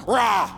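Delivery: short loud growl; mock snarl. Jesus snarling at death, short roar.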